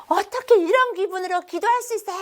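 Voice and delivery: high-pitched indignant outburst; angry child. Retold or imitated. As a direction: imitate a child protesting angrily. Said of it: "저한테 화를 내는 거예요".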